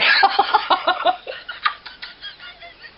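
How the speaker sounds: high helpless laughter; real giggle fit. Real, not acted. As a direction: burst out laughing, can't stop. Giggle fit during demo.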